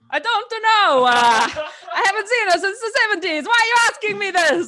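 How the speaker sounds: fast-talking New Yorker in a panic; Scorsese impression. Scorsese pleading with the hero, New York accent. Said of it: "very hard for me to do the accent".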